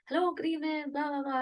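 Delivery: high sing-song stage greeting; upbeat stage persona. Acted. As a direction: greet the crowd as a bubbly, confident performer. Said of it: "upbeat, happy-go-lucky person".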